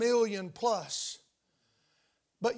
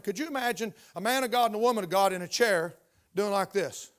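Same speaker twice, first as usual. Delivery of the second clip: normal talking voice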